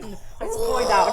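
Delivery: high, excited whoop; hammed-up thrill at what's coming; hyped reaction. Retelling own excited reaction.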